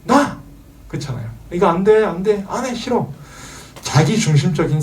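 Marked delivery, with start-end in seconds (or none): 0.0-0.4 put-on high childish yell of me; small child claiming everything; toddler shout. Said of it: "아이가". 1.5-3.1 higher, whiny string of nos; defiant toddler. Retold or imitated. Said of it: "아이가".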